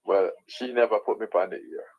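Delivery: ordinary delivery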